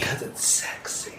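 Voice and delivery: soft teasing whisper. Pretend. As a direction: tease in a hushed voice. Playful whisper joke continues.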